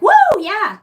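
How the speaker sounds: high loud whoop of excitement; fired-up whoop. Retold or imitated. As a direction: let out a big excited whoop. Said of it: "I'm like".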